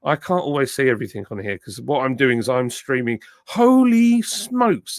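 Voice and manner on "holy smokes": amazed exclamation. Real, not acted. Streamer sees huge chat count.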